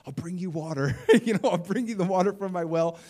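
laughing retold plea. Woman offering the pastor her well water, retold laughingly. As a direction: play the eager apology with a laugh.

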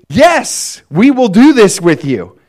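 high, eager and loud acceptance; eager yes. Acted. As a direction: shout it like a bride saying yes. Israel eagerly accepting the covenant.

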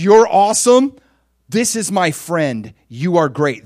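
big, emphatic praise; proud proclamation. Retold or imitated. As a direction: proclaim it to a whole crowd. Celebrated man publicly honours friend.

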